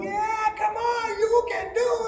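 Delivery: high-pitched, drawn-out egging on; cheering friends. Retold or imitated. Friends on the dock urge him to jump, high mock cheering. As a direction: imitate friends calling from afar.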